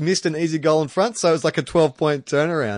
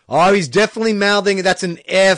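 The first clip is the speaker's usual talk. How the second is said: lightly voiced commentator, louder and higher; mock commentator; 6 semitones higher, 5 dB louder than usual. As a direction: puzzle out a lip-read aloud, stretching the swearing. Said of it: "the commentators are trying to interpret".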